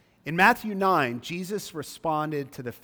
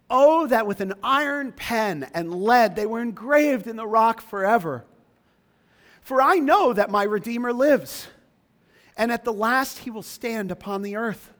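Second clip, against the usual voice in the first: high, loud fervent reading; scripture reading; 6 semitones higher, 5 dB louder than usual. Acted. Preacher reading Job's words aloud to congregation. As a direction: proclaim the verses with rising fervour. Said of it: "Read verses twenty-three to twenty-nine with me".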